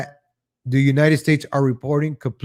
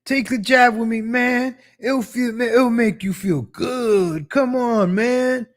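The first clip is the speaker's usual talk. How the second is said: much higher, slow coaxing junkie voice; junkie friend; 8 semitones higher, slower than usual. Retold or imitated. A junkie friend coaxing you to take the jab, high and wheedling. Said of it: "your junkie friend is like".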